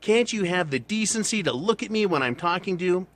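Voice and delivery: imitating a mad heroine, half-serious; mock angry woman. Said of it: "finally she gets mad and goes".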